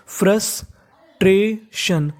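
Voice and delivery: Frustration very slowly, in three separate pieces; teacher slowly breaking frustration apart; syllable by syllable. Shown as a demonstration. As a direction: sound out frustration with clear gaps between syllables.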